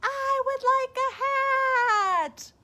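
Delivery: high, slow echo. Acted. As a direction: let it float back like an echo. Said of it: "Moon seemed to say back".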